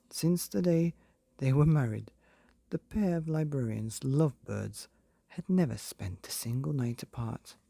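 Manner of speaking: ordinary delivery, nothing marked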